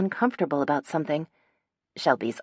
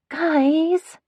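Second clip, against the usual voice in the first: high, drawn-out plea to her friends; whining; 13 semitones higher, 5 dB louder than usual. A narrator's reading. Phoebe begging her friends for help, a high whine. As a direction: whine for sympathy, stretching the word. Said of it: "I whine".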